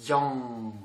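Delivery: "Yang" low, ending in a held NG; final nasal ng. Shown as a demonstration. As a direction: let "Yang" ring out into a nasal NG. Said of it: "on le prononce comme N G". (« Yang » grave, fini sur un ng nasal tenu)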